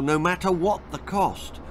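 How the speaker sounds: ordinary delivery, nothing marked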